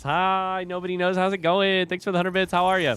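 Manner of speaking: high bright streamer welcome; excited greeting. Real, not acted. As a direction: greet chat loudly, excitedly. Greeting chat, high loud.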